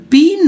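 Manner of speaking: पीन with a long ee, "peen"; wrong reading. Shown as a demonstration. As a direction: read पीन letter by letter as "peen". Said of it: "नहीं पढ़ते हैं".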